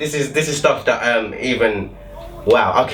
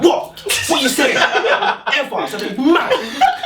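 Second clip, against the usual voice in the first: much higher mock tough talk; mock bravado; 13 semitones higher, much slower than usual. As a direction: act brave once she's gone. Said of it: "I was like".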